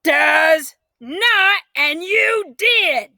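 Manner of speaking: high loud slow shout. A narrator's reading. Girl shouting at her sister in a quarrel. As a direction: yell it right back.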